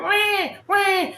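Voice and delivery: high imitated baby cry; baby wail. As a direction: mimic the crying baby.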